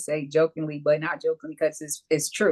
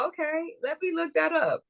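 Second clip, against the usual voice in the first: mock eager reader; 12 semitones higher than usual. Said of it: "Like, go".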